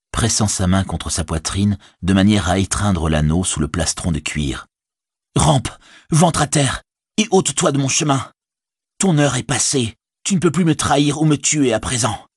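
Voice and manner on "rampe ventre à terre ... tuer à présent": raised, higher, commanding fury. Acted. Frodo orders Gollum away, higher-pitched and furious. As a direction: drive the creature off with sudden rage. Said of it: "une soudaine fureur".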